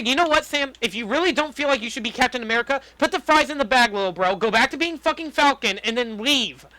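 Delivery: ordinary talk, nothing marked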